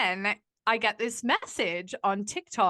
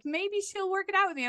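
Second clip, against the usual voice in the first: much higher mock-hopeful voice; mocking falsetto ex; 10 semitones higher than usual. Mocking her ex's wishful thinking, much higher. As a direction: mimic his hopeful thought in a silly high voice.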